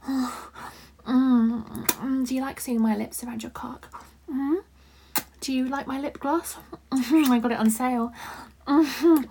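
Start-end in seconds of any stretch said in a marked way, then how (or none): none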